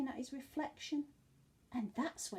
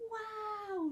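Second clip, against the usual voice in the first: excited wow; 10 semitones higher, 3 dB louder than usual. Acted. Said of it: "said Norman".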